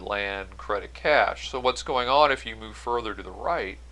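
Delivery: ordinary talk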